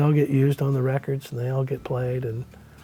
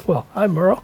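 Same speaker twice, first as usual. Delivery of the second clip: surprised hi; 6 semitones higher, 5 dB louder than usual. Retold or imitated. Surprised greeting to his hero.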